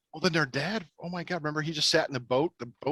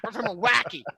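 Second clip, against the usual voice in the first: higher-pitched Milwaukee accent imitation; playful, exaggerated Milwaukee twang; mock Milwaukee accent; 6 semitones higher than usual. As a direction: mimic the girls announcing their Milwaukee roots. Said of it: "That's all I talk".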